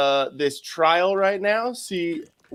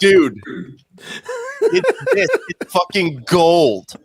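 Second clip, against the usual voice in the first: laughing out the words, pitch jumping up; cracking up at the absurd trial; slower than usual. Real, not acted.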